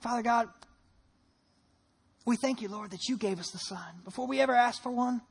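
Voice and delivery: measured, subdued prayer with long pauses. Real, not acted. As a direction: lead a bowed congregation in reverent prayer. Leads the congregation in prayer.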